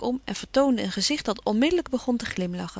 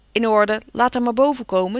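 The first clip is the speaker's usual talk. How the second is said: louder, eager call down the stairs; calling downstairs; 6 dB louder than usual. Acted. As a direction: call it down to the maid, keen to have her up. Laurie calls down to the maid. (Laurie roept luid naar beneden)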